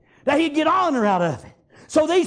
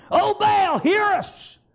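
slow, drawn-out call, rising higher; mock pleading call; 5 semitones higher, much slower than usual. Preacher as Baal's prophets calling their god, mock pleading call. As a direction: call out to a god, stretching the words.

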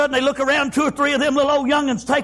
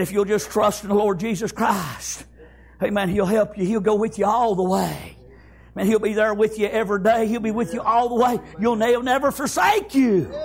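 low, calm, earnest comfort; reassuring mother; 3 semitones lower than usual. Acted. Mama promising her child the Lord's help, calm reassurance. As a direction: drop low and reassure steadily.

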